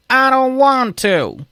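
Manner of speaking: high, loud, drawn-out mock whine; imitated balky mom. Anthony's mom posing as Doris the AI, balky refusal. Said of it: "it was really just Anthony's mom".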